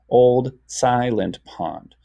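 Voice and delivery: slow, measured reading; deliberate teacher's reading; syllable by syllable. Shown as a demonstration. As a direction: read slowly, each syllable given room. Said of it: "Silent has two parts".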